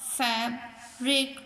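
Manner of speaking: फैब्रिक as two separate beats, फैब, then रिक; teacher sounding out फैब—रिक; split into syllables. Shown as a demonstration. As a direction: say फैब्रिक slowly, pausing before रिक. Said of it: "टू vowel sound देते हों".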